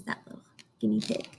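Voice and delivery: usual speaking voice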